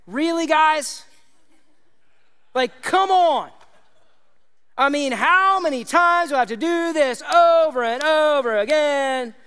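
high, loud, fed-up mock voice; exasperated mock Jesus. Mock Jesus scolding frightened disciples, exasperated. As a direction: pretend Jesus is sick of repeating himself. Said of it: "No, he doesn't do that".

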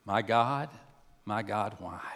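low, hushed plea; quiet and low, almost to oneself; subdued questioning prayer. Acted. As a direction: ask God softly, sinking away. Voicing a believer's question to God.

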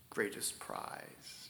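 slow breathy whisper; hushed whisper. Real, not acted. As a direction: breathe the last words in awe. Hushed climax of quote.

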